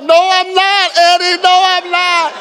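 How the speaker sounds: high, loud, wailing denial; tearful protest. Retold or imitated. New dad protesting the worship song, high mock wail. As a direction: cry out that you're no good father. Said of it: "I was like".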